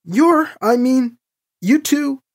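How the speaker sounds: high-pitched, halting disbelief; slow, broken start rising to baffled surprise; stunned fumbling. A narrator's reading. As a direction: grope for words, then blurt the question. Stunned by the pregnancy news.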